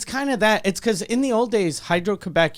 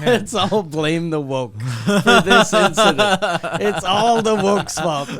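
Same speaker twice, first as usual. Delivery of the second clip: laughing sarcasm; 5 dB louder, slower than usual. Real, not acted.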